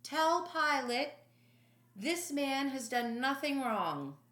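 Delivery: loud, high call from a distance. Acted. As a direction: shout it down the street. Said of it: "I heard Herod call out".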